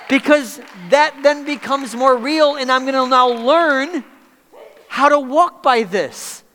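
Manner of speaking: high, loud sermon delivery; impassioned preaching. Real, not acted. Preaches at raised force.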